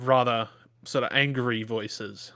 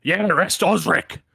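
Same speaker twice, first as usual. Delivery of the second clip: loud, furious farmer demanding an arrest; angry accusing shout; 6 semitones higher, 8 dB louder than usual. Acted. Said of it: "just goes".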